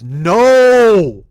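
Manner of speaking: sharp loud cry of no; retold shout. Girlfriend stopping him at the airport counter, a loud desperate cry. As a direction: stop him with one loud yelled word. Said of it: "she literally goes".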